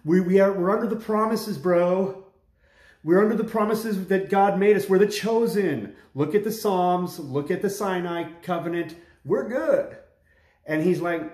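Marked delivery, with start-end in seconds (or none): 0.0-10.0 raised, smug and dismissive; mock-complacent believer brushing off a warning; smug churchgoers. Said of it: "they said".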